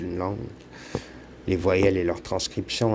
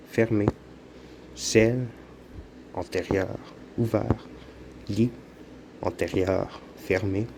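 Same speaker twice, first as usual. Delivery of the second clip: usual speaking voice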